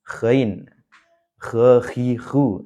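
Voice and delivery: Ghain, Ghe, hi, hu, each with throat friction; light throaty gh. Shown as a demonstration. Teacher showing it is easy, Ghe, hi, hu with light gh. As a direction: start Ghe, hi, hu on an easy upper-throat rasp. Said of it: "pelafalannya ringan kok sebenarnya".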